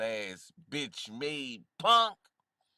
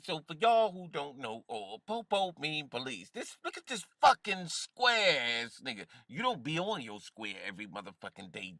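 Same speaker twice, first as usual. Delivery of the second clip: ordinary talk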